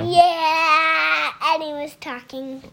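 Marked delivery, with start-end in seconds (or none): 0.0-1.3 lightly imitated baby brother; louder, drawn-out mock toddler voice; squeaky little voice. Copying baby brother Lincoln from the other room, mock squeaky. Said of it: "squeaky, cute little voice".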